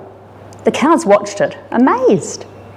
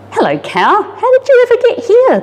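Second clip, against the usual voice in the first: put-on a little girl amazed to find a cow.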